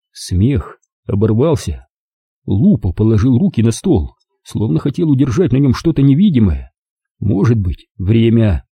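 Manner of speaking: usual speaking voice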